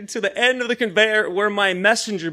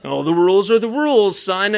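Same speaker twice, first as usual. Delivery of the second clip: mock cop voice; 4 dB louder than usual. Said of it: "He's like".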